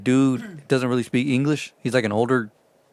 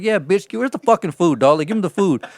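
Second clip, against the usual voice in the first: higher, cocky demand; 7 semitones higher, 3 dB louder than usual. Retold or imitated. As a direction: demand the food from the cook. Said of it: "And I'm like".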